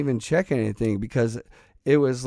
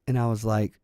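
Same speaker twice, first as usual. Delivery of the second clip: ordinary talk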